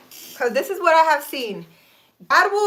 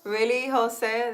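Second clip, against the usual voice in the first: normal talking voice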